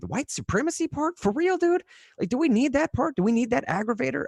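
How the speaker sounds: high disbelieving questions; incredulous. Real, not acted. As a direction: push back in disbelief. Objects to the headline wording.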